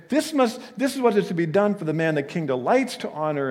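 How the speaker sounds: high, loud, proud herald cry; gloating proclamation. Acted. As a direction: announce it as your own triumph. Haman imagines proclaiming his own praise.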